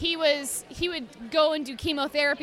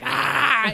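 mock cat yell; 8 dB louder than usual. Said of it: "he was like".

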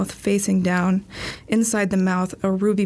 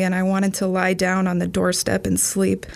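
ordinary delivery, nothing marked

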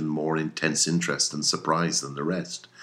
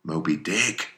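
usual speaking voice

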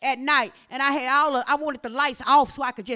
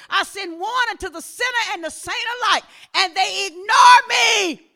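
raised, building sermon voice rising to a shout; forceful preaching; 6 semitones higher, 5 dB louder than usual. Acted. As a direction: preach it as God's grieved warning, building. Preaching God's warning with force.

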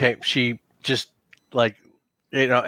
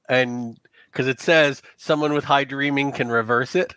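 ordinary delivery

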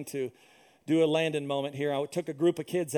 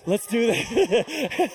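drawn-out bravado; 4 semitones higher, 5 dB louder, much slower than usual. Retold or imitated. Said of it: "And I was like".

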